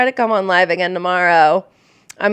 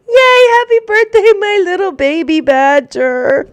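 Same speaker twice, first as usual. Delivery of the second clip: excited cheer; 12 semitones higher, 5 dB louder than usual. Real, not acted. Mother cheering her little girl's birthday, excited and high.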